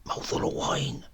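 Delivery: voiceless conspiratorial whisper; whispered sly request. A narrator's reading. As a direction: breathe it like a secret. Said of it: "he whispered".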